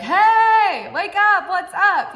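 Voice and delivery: high, loud wake-up yell. Shown as a demonstration. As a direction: yell it like you're rousing someone. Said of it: "even get louder and, like, yell at you".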